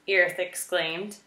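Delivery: ordinary delivery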